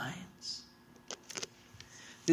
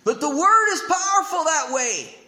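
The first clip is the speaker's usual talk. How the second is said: preaching loud; 10 semitones higher, 7 dB louder than usual. Real, not acted. Sermon climax.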